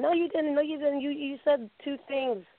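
high, insistent mom voice; imitating mom. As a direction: mom refusing to believe her daughter, high. Mom catching her out.

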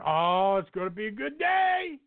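high, rising excited cheer; gleeful anticipation. Acted. Host acts out fantasy owner licking his chops, gleeful anticipation. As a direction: crow it like a thrilled fan, pitch soaring.

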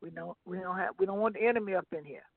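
normal talking voice

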